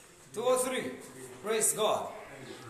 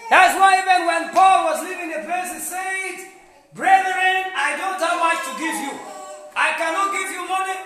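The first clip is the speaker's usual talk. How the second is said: raised, high preaching voice; forceful preaching; 7 semitones higher, 8 dB louder than usual. Real, not acted. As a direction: proclaim it to the congregation, loud at the start and close. Preaches Paul's farewell words.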